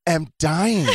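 milk it like a tragic heroine; melodramatic dying. Retold or imitated. Feverish speaker to boyfriend, slow mock-tragic lament.

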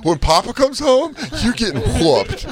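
mock stern dad. Mock threat, dad returns.

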